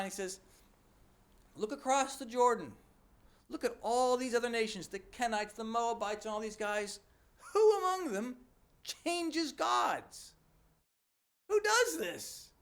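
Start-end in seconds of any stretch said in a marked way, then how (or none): 1.6-12.5 much higher and louder, rising to an outraged cry; incensed disbelief. Retold or imitated. The Lord showing Jeremiah the nations, incensed and loud. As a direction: throw the question out, outraged. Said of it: "he's incensed and in disbelief".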